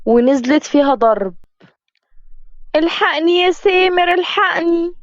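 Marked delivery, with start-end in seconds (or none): 2.8-4.9 high panicked cries; calling for help. Acted. Fiancée being beaten calling Samer, high panicked pleas. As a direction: cry out to be rescued.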